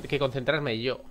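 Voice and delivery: ordinary talk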